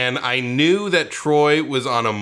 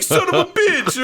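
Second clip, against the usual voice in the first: high, laughing put-on buddy voice; a hint of a cocky movie star; mock rowdy greeting. Kurt Russell flicking his cigarette, lightly voiced rowdy greeting.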